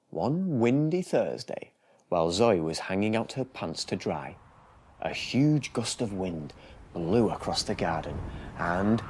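put-on lively storybook reading for small children; bedtime story narration. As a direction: read it like a bedtime picture book.

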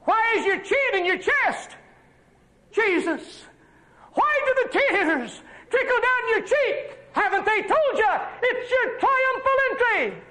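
high, ringing pulpit voice; full-force preaching. Acted. Preacher calling out to Jesus on the donkey, full-force preaching. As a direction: preach it out to the congregation, pitch up.

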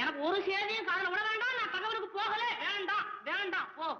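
high-pitched, worked-up protest; distraught refusal. Acted. மனமுடைந்து மறுத்துப் புலம்பல். (பதற்றமான உச்சக்குரல் மறுப்பு)

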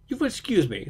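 mild, smooth politeness; bland. A narrator's reading. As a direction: excuse yourself with bland courtesy. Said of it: "said Holmes blandly".

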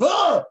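sharp, high, loud mock cough; a loud staged cough; imitated cough. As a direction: bark out a sudden fake cough. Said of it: "the wife who suddenly coughed".

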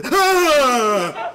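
loud, high scream sliding downward; shocked scream. Acted. Husband comes to the closet, screaming. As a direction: let out a falling yell of shock.

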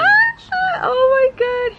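thrilled shriek over the chain; excited scream. Real, not acted. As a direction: scream with delight, barely holding it in. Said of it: "I feel like I can't scream".

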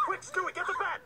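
high-pitched, urgent order; urgent panic. Acted. Intruder calling accomplice for help, urgent panic. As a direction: call out in a panic.

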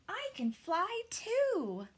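high, slow delighted bat voice; a baby bat proud she can fly; eager. A narrator's reading. Bat's joyful thought.

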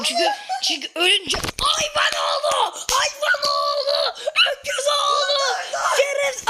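furious gamer yelling; angry shout. Real, not acted. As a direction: scream at the screen. Yelling insults at game.

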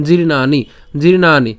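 জির্ণানি twice, firmly sounded out; careful pronunciation. Shown as a demonstration. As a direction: drill জির্ণানি with a long "naa". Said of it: "একটু মনে রাখবেন". (জির্ণানি জোর দিয়ে স্পষ্ট উচ্চারণে)

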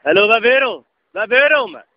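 irate, forceful questioning. Real, not acted. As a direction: throw the question back heatedly. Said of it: "Lad være med at stå og råbe".

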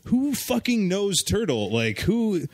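high, disbelieving squawk; quoting a friend's incredulous outburst about Turtle. Retold or imitated. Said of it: "Sam was just like".

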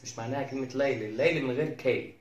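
ordinary delivery

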